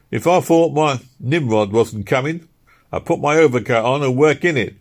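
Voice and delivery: lightly voiced labourer moaning about the cold; Philpot's voice.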